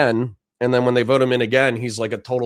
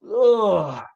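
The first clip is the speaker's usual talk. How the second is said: a long weary yawning ugh; tired yawn groan; 10 semitones higher than usual. Real, not acted. Tired streamer yawning on a long weekend. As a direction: let a yawn out mid-stream. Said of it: "Sorry for yawning".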